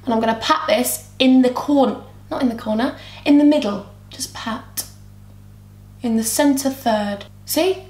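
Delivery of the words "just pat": whispered aside, much softer; whispered tip while patting on shadow. Real, not acted. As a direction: breathe it out under the voice. Quiet aside while applying.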